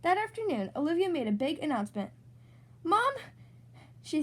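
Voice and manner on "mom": calling mom. A narrator's reading. Big announcement to mom.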